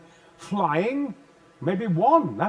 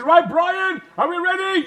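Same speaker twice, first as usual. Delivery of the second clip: film director calling; 11 semitones higher, 9 dB louder than usual. Retold or imitated. Quoting film crew.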